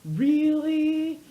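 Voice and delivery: high, loud disbelieving squeal; mock disbelief; incredulous really. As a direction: act shocked she'd refuse. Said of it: "And she's just like".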